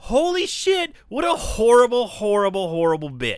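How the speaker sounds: retelling host disgusted by the character; disgusted outburst. Real, not acted. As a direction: start worked up, then wind down. Reacting to the mean character.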